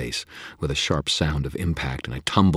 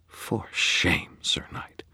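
dreamy; 3 semitones higher, much slower than usual. Acted. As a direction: drift the words dreamily. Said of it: "her voice dreamy".

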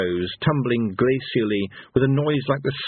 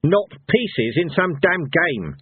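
slow, high angry retort; snapping; 7 semitones higher, much slower than usual. A narrator's reading. Cohen objects to being a game piece, snapping. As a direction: bite back slowly, pitch raised. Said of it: "snapped Cohen".

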